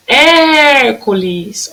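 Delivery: "Hércules" called out with stretched first syllable; stressed first syllable. Shown as a demonstration. Teacher showing the accent, drawn-out "Hér" in "Hércules". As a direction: hold the "Hér" of "Hércules" very long. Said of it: "o acento agudo na primeira sílaba".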